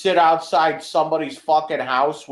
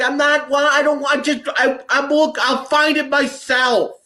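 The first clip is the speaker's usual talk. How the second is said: pitched much higher, flustered and fed up; exasperated protest; 9 semitones higher than usual. Retold or imitated. Son snapping at dad who tears the house apart, exasperated high protest. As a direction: brush off his help, voice climbing in frustration. Arguing with dad over help.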